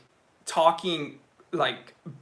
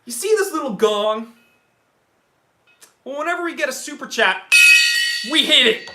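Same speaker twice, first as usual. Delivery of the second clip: high, loud build-up to the gong; hyped-up showman; 7 semitones higher, 9 dB louder than usual. Real, not acted. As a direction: hype the crowd, louder to the end. Hyping the gong tradition to stream.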